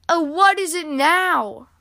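slow, high, loud weary complaint; exasperated sigh. A narrator's reading. Grumpy Badger hears banging at the door, sighing in exasperation. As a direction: drag it out, thoroughly fed up. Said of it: "Grumpy Badger sighed".

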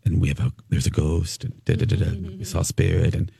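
mock a mild-mannered ghost hunter; understated low droning presenter; imitating a calm presenter. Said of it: "this is one of Dawn's co".

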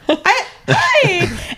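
high, giggly flustered hi; nervous laugh of shock; starstruck laugh. Retold or imitated.

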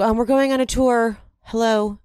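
imitated bossy hostess. Voicing Angie taking over the group.

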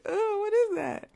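high, loud wail of disgust; mock revulsion. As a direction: recoil in hammed-up horror, high and loud. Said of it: "I just be like".

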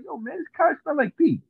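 high mock Indian accent. Guy at the table complaining the cards smell, high mock Indian accent. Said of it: "he did it in his little accent".

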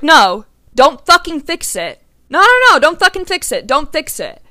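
high, loud, insistent refusal; retold shout. Telling the brother over the phone, loud insistent no. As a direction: yell it back at him, no no no.

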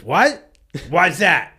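loud, high, indignant what; mock-outraged shout. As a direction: bark it back at the stranger in disbelief. Tim challenges rude driver.

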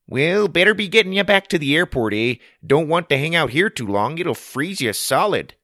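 high, loud folksy Canadian; Canadian character. Acted. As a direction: call it out as a hearty Canadian. Canadian character Barry speaks.

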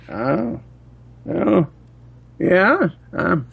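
put-on high, slow old man; old person voice. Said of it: "your old person telemarketer voice".

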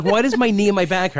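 mock whine with a laugh; laughing complaint.

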